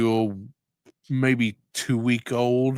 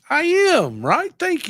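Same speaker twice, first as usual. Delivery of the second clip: playful squeal; 5 dB louder, slower than usual. Real, not acted. Playful reply in high voice.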